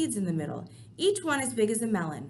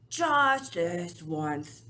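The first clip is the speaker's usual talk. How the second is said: very slow grudging giant; 3 semitones lower, much slower than usual. Acted. Giant relents slowly.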